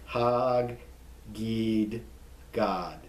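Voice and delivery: "Hagid Gad" split into hag, gid, gad; slow, drawn-out syllables with pauses on "Hagid Gad"; every consonant pronounced. Shown as a demonstration. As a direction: sound out both g's in "Hagid", then "Gad". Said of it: "You pronounce every consonant there".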